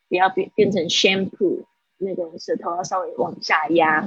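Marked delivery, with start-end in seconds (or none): none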